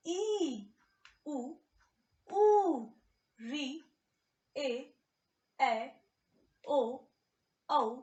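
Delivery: each vowel sounded slowly and clearly for learners; vowels drawn out one by one. Shown as a demonstration. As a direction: model every Hindi vowel with a long clear pause. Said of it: "फिर से एक बार बता दूं". (हर स्वर को धीरे और साफ़ बोलकर दिखाना)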